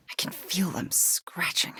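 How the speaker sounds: whispered, as if something might overhear; hushed whisper. Acted. Haunted hero recalling the temple of Chthon, whispered. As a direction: breathe it, barely voiced.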